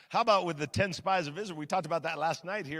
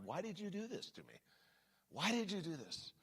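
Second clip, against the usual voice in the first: hurt question; 2 semitones higher, 12 dB quieter than usual. Retold or imitated. Retold hurt question to betrayer.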